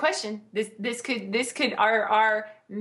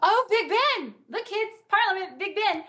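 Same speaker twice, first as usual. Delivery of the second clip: higher, a lightly voiced excited tour guide dad; touristy dad; 9 semitones higher than usual. Mocking London clichés in films as a lightly voiced touristy dad. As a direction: point out landmarks to the kids, a hint of a giddy tourist.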